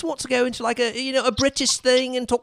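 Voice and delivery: posh British English demo; British accent. Shown as a demonstration. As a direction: slip into a prim and proper British accent. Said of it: "talk like this".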